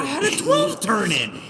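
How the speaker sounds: high, loud, drawn-out excuse; loud protest. Real, not acted. Teased racer defends the loss, loudly protesting. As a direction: protest it slowly at a high pitch.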